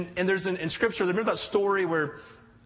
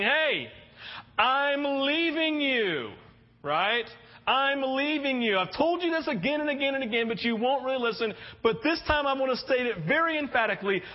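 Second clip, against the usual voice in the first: raised, earnest voice of Jesus parting; earnest Jesus, a touch higher; 6 semitones higher than usual. Acted. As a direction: plead with them that you are leaving. Jesus voiced telling disciples he leaves.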